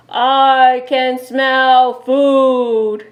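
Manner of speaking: slow, loud booming bear voice; bear yell. Acted. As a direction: boom it out like a hungry bear. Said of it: "yelled a voice from nearby".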